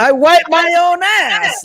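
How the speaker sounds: high, slow put-on kid voice; Big Daddy kid quote. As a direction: squeak it out like a little kid in a movie. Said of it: "the Adam Sandler movie with Big Daddy".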